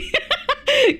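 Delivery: short high giggle. Real, not acted. Streamer laughing at herself for being a nerd, a short high giggle. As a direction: laugh it off quickly.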